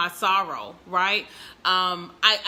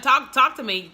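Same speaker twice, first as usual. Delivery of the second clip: ordinary talk, nothing marked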